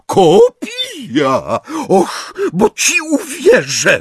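slow, loud, very high scornful shriek; Bellatrix. A narrator's reading. Bellatrix disbelieves Hermione's claim, scornful screaming. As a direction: shriek it, stretching the words. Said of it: "wrzasnęła Bellatrix".

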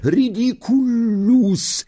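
high, drawn-out cry of an incantation; long ringing shout; shouted spell. A narrator's reading. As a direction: cry the spell out high, stretching the last syllable. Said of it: "krzyknęła Parvati".

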